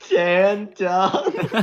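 incredulous laugh through the name; amused disbelief, laughing. Real, not acted.

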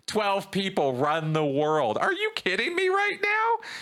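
rising pitch, incredulous at a silly theory; voice gets high. Retold or imitated. Arguing with conspiracy theorists, exasperated high-pitched disbelief. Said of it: "my voice gets high".

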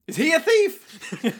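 high, slow, drawn-out disbelief; mock incredulity. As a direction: squeal the question in exaggerated bafflement. Mock confusion at retold fight banter.

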